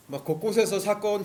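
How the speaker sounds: ordinary talk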